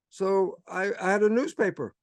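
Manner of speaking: ordinary talk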